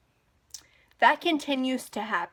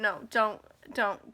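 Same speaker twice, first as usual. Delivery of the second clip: ordinary delivery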